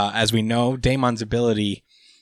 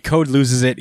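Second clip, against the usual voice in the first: normal talking voice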